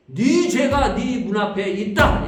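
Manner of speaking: slow, loud pronouncement; solemn voice of God. Acted. God warning Cain at his door, slow, loud and solemn. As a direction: deliver it like a divine warning, weighty.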